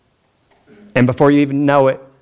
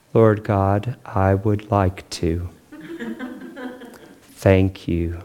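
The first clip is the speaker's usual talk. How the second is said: a hint of a droning pray-er, lower and slow; monotone prayer; 8 semitones lower, 6 dB quieter, much slower than usual. Mocking the man next to him praying, lightly droning monotone. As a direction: drone it out like a sleepy pray-er. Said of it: "prays in this monotone voice".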